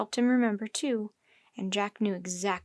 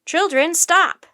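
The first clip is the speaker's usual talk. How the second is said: loud, high, drawn-out order; slow, forceful high shout; 6 semitones higher, 10 dB louder, slower than usual. A narrator's reading. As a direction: shout to halt running children. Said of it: "the professor shouted".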